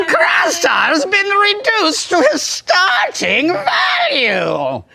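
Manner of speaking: high, slow, wailing roar; Krastar. Pretend. Player mourning his lost points, as an obnoxious wailing character. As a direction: lament theatrically in a strained monster voice. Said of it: "get that voice out of here".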